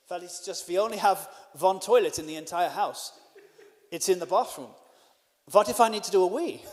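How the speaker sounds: put-on clumsy foreign accent, W said as V; terrible put-on accent. Host explaining the one toilet, terrible put-on accent. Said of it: "sorry for the terrible accent".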